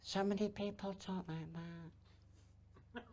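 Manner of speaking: very soft, slow, barely audible; tiny low-volume voice. Shown as a demonstration. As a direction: imitate people who hardly speak up. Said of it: "volume of voice".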